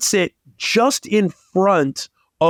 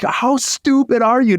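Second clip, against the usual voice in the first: insulting owner; 8 semitones higher than usual. Retold or imitated. Owner insulting the kid to his face, mock scorn. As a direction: sneer it like an outraged owner. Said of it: "He's like".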